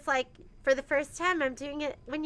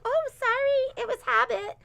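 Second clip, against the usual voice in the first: imitating a sweet girl, much higher; sweet apology; 12 semitones higher, 4 dB louder than usual. Mom quoting daughter apologizing sweetly, high and sweet. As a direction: chirp a little apology. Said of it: "like, so sweet".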